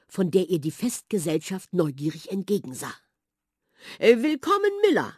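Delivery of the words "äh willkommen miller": high, loud, flustered royal greeting; flustered king, caught off guard; hesitant king. A narrator's reading. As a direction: stammer the welcome like an unsettled king. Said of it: "sagte König Erwin".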